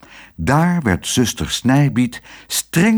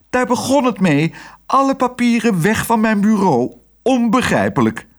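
high-pitched, helpless lament; distressed nurse voice; wailing nurse; 13 semitones higher than usual. A narrator's reading. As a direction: keep up the high, bewildered whine. Flustered nurse keeps lamenting.

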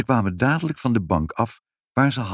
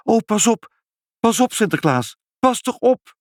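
high, loud cry of alarm; panicked high-pitched calls; alarmed warning; 14 semitones higher, 4 dB louder than usual. A narrator's reading. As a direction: cry out urgently to stop a fall.

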